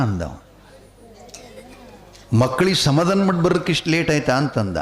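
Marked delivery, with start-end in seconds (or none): 2.3-4.4 quicker, higher echo of an excuse; king doubting a lame excuse; incredulous question. Acted. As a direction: question it in disbelief, rattled off fast. King questions Birbal's excuse for lateness.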